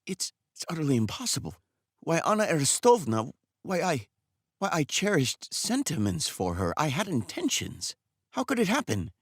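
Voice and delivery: a timid barber who can't take in the news; a jilted barber pleading with the girl's father; shocked barber Makar. Acted.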